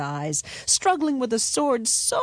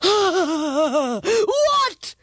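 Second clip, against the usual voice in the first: high loud startled cry; shocked disbelief, loud; flabbergasted; 10 semitones higher, 6 dB louder than usual. A narrator's reading. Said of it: "cried the dragon, flabbergasted".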